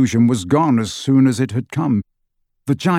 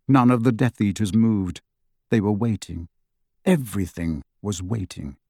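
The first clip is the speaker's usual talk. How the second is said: plain reading voice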